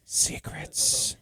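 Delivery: breathy stage whisper. Pretend. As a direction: whisper it like a secret. Whispering secrets as a joke.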